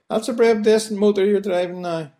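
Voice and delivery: strong Belfast accent admiring a car; broad Belfast accent. Shown as a demonstration. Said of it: "we'll say".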